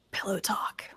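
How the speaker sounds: unvoiced teasing whisper. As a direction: whisper it as a sly aside. Whispered aside about the ring.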